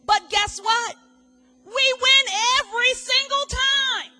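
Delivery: high, loud declaration; triumphant. Acted. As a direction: proclaim victory.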